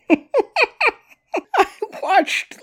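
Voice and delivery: bursts out laughing high on the words. Real, not acted. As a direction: laugh through it while saying what you watched. Breaks into a laugh mid-sentence.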